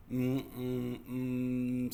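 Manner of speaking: closed-mouth muffled humming; gagged mumbling. Acted. Little sister with sock in mouth, muffled mumbling. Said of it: "Don't speak with your mouth full".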